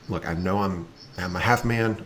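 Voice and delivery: usual speaking voice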